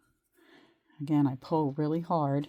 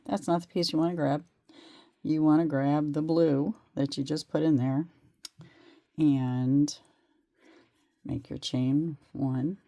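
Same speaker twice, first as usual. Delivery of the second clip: ordinary delivery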